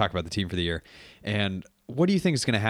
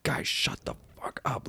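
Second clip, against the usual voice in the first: harsh whispered scolding; 8 semitones lower, 6 dB quieter than usual. Retold or imitated. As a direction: hiss it low at the kids mid-speech. Coach hushing rowdy kids.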